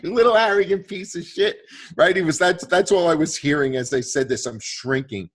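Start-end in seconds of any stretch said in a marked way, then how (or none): 0.0-1.6 mock insult. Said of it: "he was like".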